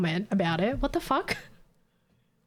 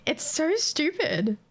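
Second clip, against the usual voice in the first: ordinary delivery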